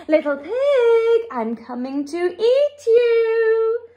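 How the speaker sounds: high, slow, drawn-out menacing shout. A narrator's reading. Wolf shouting down the chimney to the pig, drawn-out shout. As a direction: holler the threat, stretching every word. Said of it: "he shouted down the chimney".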